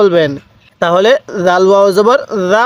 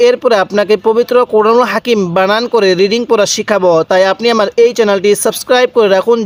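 ordinary talk

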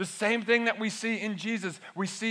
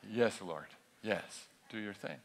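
low, soft, sheepish giving in; meek Martha; 9 semitones lower, 9 dB quieter than usual. Retold or imitated. Preacher voices Martha meekly backing down. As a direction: mumble it like a chastened Martha. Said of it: "Martha answers Jesus".

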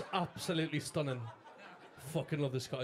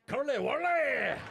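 loud, high, drawn-out mock Glaswegian; Glaswegian accent; 9 semitones higher, 5 dB louder, slower than usual. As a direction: belt out "Curly whirly" in an exaggerated Glasgow accent. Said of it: "I fucking love the Glaswegian accent".

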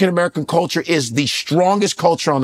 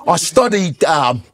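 imitated British interview voice, hesitant; British accent. Idris talking on BBC about The Wire, British accent. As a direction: do a refined British actor, with pauses. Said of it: "I'm watching B B C".

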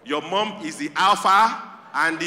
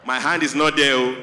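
lower, louder warning; a hint of menacing mother voice; 5 semitones lower, 3 dB louder than usual. Mother warning her daughter in a lower, louder voice. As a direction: warn low and heavy. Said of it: "You just hear one voice say".

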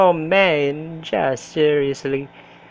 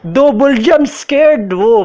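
excited; 7 semitones higher, 5 dB louder than usual. Real, not acted. Right after jump scare.

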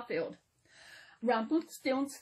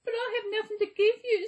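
high, fast, tearful sob; sobbed; 10 semitones higher, faster than usual. A narrator's reading. Girl sobs to the little man, high and fast. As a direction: blurt it out through tears.

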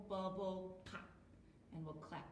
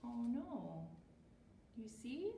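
usual speaking voice

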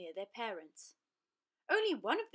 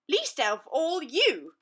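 scornful lion; 6 semitones higher, 10 dB louder, slower than usual. Acted. Lion dismisses mouse.

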